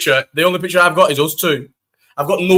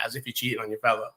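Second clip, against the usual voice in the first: quiet mock reply; 6 semitones lower, 12 dB quieter, faster than usual.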